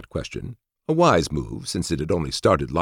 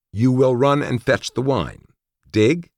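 high, emphatic order, a touch louder; rephrased command; 9 semitones higher, 4 dB louder than usual. A narrator's reading. Narrator orders Cortez to fetch wine, high and insistent. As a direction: press the order home, pitched up. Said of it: "Let me rephrase that".

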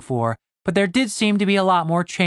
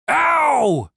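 high, falling howl of pain; acted yelp; pained shout; 10 semitones higher, 3 dB louder than usual. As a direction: cry out as if just hit, pitch way up. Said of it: "shouted Gareth".

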